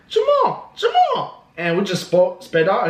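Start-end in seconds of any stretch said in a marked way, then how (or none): 0.1-1.4 high falsetto woman calling after him; squeaky-high mock yell; imitated lady calling out. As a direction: call his name in a mock lady voice. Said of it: "And she's like".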